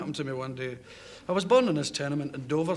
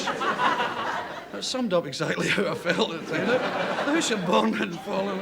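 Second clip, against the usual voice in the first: slow, laughing through it; amused disbelief, words broken by laughter; 5 semitones higher, 4 dB louder, slower than usual. Real, not acted. Telling the interviewer about his birthplace falling, laughing slowly. Recalling the building collapsing, laughing.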